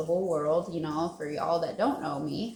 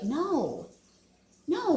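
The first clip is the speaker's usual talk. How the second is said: ordinary talk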